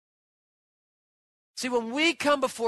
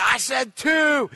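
loud, high, drawn-out retort; kid yelling back; 4 semitones higher, 5 dB louder, slower than usual. Retold or imitated. Boy insisting on his foul ball, loud childish protest. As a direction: mimic a child's stubborn shout.

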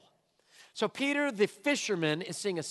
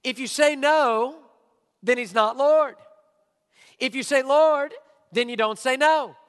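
preacher pressing the point on the church; loud preaching; 6 semitones higher, 9 dB louder than usual. Real, not acted. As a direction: hammer each half of the contrast. Driving home the oxymoron.

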